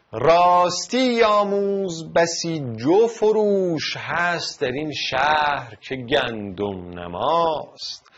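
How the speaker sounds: slow recited verse with a lifted opening; slow formal verse reading; poem recitation. Shown as a demonstration. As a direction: recite the couplet slowly like poetry. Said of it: "پروین اعتصامی".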